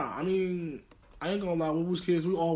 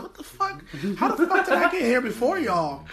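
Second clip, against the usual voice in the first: higher and louder, laughing disbelief; mock shocked outburst; 7 semitones higher, 6 dB louder than usual. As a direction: blurt it out amazed and laughing. Shocked reaction, retold.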